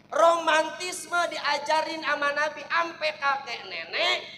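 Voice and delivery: ordinary delivery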